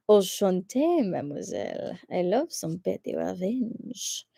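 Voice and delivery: slow, soft French purr; hammed-up French suitor with a drawn-out laugh; mock French accent. Streamer savoring petty revenge, mock French accent.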